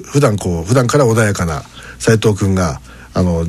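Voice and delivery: ordinary talk, nothing marked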